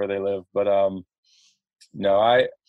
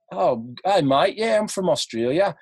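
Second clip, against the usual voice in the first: higher, put-on Aussie accent; Australian accent; 6 semitones higher than usual. Husband bluffing a stranger in a fake Australian accent. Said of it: "showing off my Australian accent".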